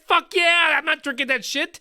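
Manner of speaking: high, loud quoted outburst; mock emphatic outburst. Quoting street interviewees to co-host, high mock outburst. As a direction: blurt it like the people polled.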